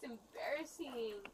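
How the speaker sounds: normal talking voice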